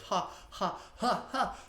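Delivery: ha breaths rising into a high laugh; high cascading laugh; demo laugh cascade. Shown as a demonstration. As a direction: let the breaths tip into laughter. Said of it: "So it's gonna be like".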